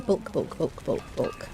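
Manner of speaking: fading whispered repeat; muttered under breath. Real, not acted. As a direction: mutter it while scanning a list. Muttering while searching menu.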